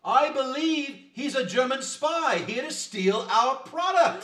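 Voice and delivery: loud, high and slow, stirring up the crowd; rousing accusation. Acted. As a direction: point the finger and rally the mob. Huckster rouses crowd against stranger.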